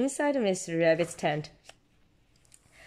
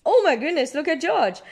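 high, louder amazed exclamation; excited exclaim; 8 semitones higher, 4 dB louder than usual. A narrator's reading. Parent voicing Peppa's high amazed exclamation. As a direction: call out in amazement.

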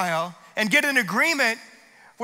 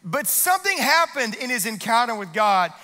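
normal talking voice

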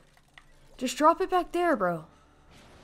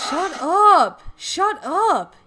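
loud, higher shout of annoyance; annoyed yell; 5 semitones higher, 9 dB louder, much slower than usual. Real, not acted. Yelling at other player.

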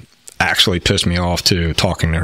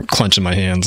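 ordinary talk, nothing marked

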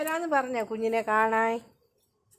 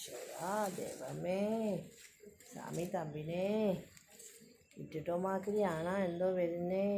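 mock spooky; 4 semitones lower, 10 dB quieter than usual. Pretending something scary is coming.

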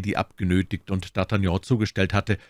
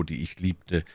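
ordinary delivery, nothing marked

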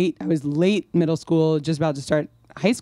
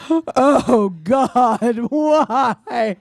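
high, loud mock wail of grief; mock sobbing wail; 7 semitones higher, 5 dB louder, much slower than usual. Sister and mom crying in front seat, mock wail. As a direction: ham up crying relatives.

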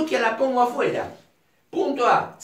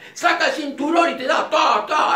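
sharp raised retold shout with belt smacks; acted dad with belt; 4 semitones higher, 5 dB louder than usual. Father lashing out at his kid, raised mock shout.

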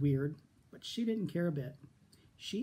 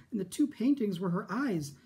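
ordinary delivery, nothing marked

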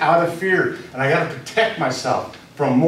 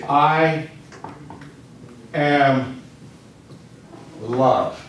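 long pauses between "I", "am", "love"; word by word; much slower than usual. Shown as a demonstration. Models the replacement phrase slowly.

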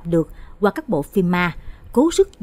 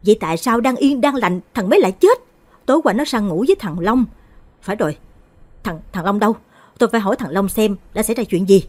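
angry shout; 3 semitones higher, 4 dB louder than usual. Acted. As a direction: bellow it, grief turning to rage. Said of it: "Chú Mạnh gào lên". (người cha gào lên đòi hỏi thằng Long)

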